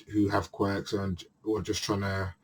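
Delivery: ordinary talk, nothing marked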